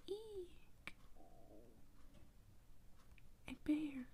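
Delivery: small high-pitched startled squeal; girl's high, contained cry of fright; little shriek. Acted. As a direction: let out a tiny storybook shriek. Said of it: "shrieks just a little".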